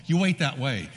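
ordinary talk

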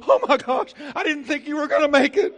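loud, high, fast mock astonishment with a laugh; laughing mock shock at the doorbell; mock surprise. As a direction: greet the pizza man like a miracle, laughing. Surprised greeting at door.

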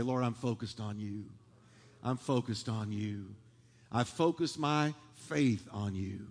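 soft, low, unhurried prayer; quiet prayer. Shown as a demonstration. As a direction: model a quiet prayer for the congregation. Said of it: "just look at him and say".

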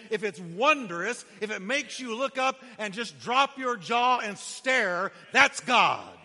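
loud, rising preacher cry building to a shout; full-force preaching. Acted. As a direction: preach it hard to the congregation.

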